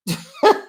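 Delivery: high loud laugh; burst of laughter. Real, not acted. Laugh alone.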